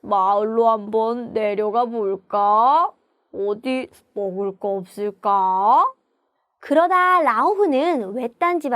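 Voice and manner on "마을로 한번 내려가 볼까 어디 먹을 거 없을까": hungry tiger. Acted. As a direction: play a famished tiger wondering about food. (배고픈 호랑이 목소리)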